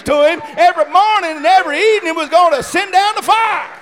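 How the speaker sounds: loud, high, driving sermon cadence; full-force preaching. Real, not acted. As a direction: preach it hard and build.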